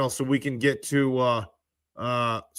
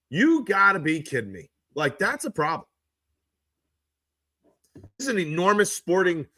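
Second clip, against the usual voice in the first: normal talking voice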